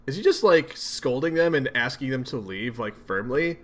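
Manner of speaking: ordinary talk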